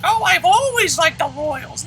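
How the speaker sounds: high, slow, mocking fan voice; mock the fake Royals fan, high and drawn out; fake bandwagon fan. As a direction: sneer it like a fair-weather fan. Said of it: "jack-off that's like".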